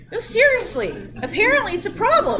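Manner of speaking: high, loud, half-laughing disbelief; can't believe she's reading this; incredulous excitement. Real, not acted. Amused incredulous aside to class.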